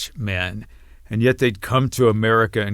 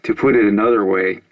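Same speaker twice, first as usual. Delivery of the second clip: louder formal statement read; formal announcement; 5 dB louder than usual. Acted. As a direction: declare it like a press statement. Reads coalition announcement on TV.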